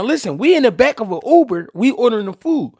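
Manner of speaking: usual speaking voice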